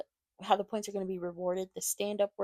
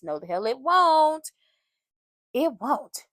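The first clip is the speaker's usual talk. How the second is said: loud, slow, emphatic denial; sassy, slow and loud rebuttal; 2 semitones higher, 6 dB louder, slower than usual. Real, not acted. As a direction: slam the answer down. Emphatic answer to own question.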